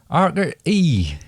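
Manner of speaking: slow West Country accent; a drawn-out West Country burr. Shown as a demonstration. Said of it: "move on to the West Country".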